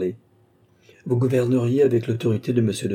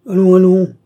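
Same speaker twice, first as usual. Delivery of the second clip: loud, high-pitched cry; old marshal's emphatic outburst; exclaiming old marshal; 10 semitones higher, 12 dB louder than usual. A narrator's reading. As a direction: cry out sharply in protest. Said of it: "s'écria le vieux maréchal".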